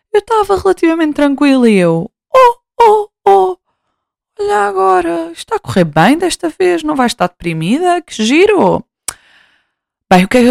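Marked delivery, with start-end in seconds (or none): 2.3-8.8 mock cheerful. Mocking her own cheerful self.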